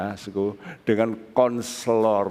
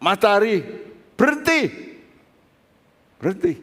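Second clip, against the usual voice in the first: commanding call; 9 semitones higher than usual. Retold or imitated. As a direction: call out as if ordering the sky to halt. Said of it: "Yosua berkata".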